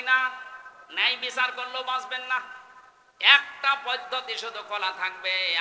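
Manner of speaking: high, loud insistent declaration; full-force preaching. Real, not acted. Preacher tells the crowd none survive strict justice, loud preaching. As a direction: ring each clause out high and loud.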